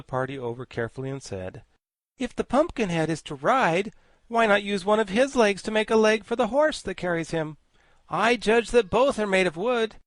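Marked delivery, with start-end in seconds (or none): 2.2-10.0 high, loud, grandly learned insect; high pompous bug voice; pompous Woggle-Bug. Acted. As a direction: lecture like a vain scholar bug. Woggle-Bug proposes the idea.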